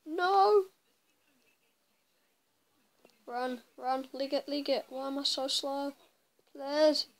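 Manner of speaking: panicked shouting, loud; being chased. Real, not acted. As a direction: yell in panic while fleeing.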